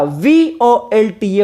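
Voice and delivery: वोल्टा spelled slowly, one letter at a time; spelling for students to copy. Shown as a demonstration. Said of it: "क्या कहा जाता है?".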